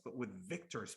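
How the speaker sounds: ordinary delivery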